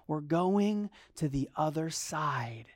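tender reassurance; calm promise. Acted. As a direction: say it as a gentle promise. Quoting Jesus' promise.

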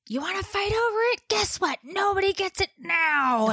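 high, strained imitation of mom rage; retold screaming. Mom retelling her outburst to fighting kids, mock screaming. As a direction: re-enact losing it at the kids, high-pitched. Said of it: "I'm screaming, and I'm like".

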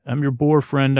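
usual speaking voice

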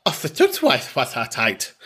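"Offatutwaitwatatite" garbled with extra stuttered syllables; mock-mangled "Offatutwaitwatatite"; tongue-tied attempt. Shown as a demonstration. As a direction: trip over "Offatutwaitwatatite" as if unable to say it. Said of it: "can't even say it".

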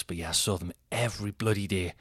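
ordinary talk, nothing marked

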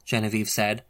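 usual speaking voice

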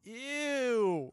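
loud, high disgusted ew. Real, not acted. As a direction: recoil in disgust. Reacts to quinoa.

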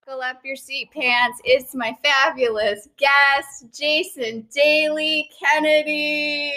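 high, stretched-out announcer build; rising show-host fanfare; excited guest introduction. Real, not acted. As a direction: hype the guest, drawing out the name. Host hypes the guest's entrance.

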